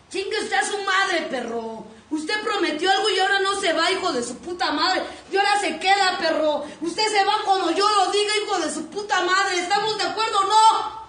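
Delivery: loud, high angry tirade; shouting down the man who wants to leave; furious scolding. Acted. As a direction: tear into him, demanding. Acted scolding of partner.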